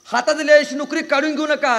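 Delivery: high, loud begging; mock tearful pleading. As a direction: beg loudly to keep the job, acted. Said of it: "रडायला लागलं".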